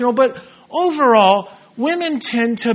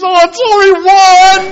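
high, loud sobbing protest; crying kid; 9 semitones higher, 10 dB louder than usual. Retold or imitated. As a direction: sob it out like a heartbroken boy. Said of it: "And he's crying".

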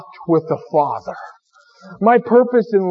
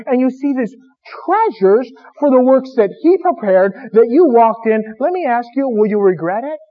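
higher and louder, driving each question home; full-force preaching; 7 semitones higher, 4 dB louder than usual. Acted. Preacher pressing the congregation about eternity, full-force preaching. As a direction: preach it hard, every "will you ever regret" climbing.

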